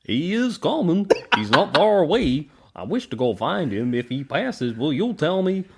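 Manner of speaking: silly cartoon voice; Goofy voice. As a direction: ring wraith rendered as Goofy. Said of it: "have the same accent as Goofy".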